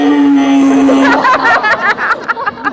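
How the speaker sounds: long, loud honking laugh; imitated horn laugh. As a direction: giggle that comes out as a horn blast. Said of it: "as she's giggling, she just goes".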